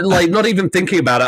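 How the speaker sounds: usual speaking voice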